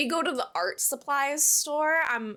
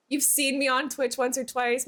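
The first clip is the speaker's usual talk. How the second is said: normal talking voice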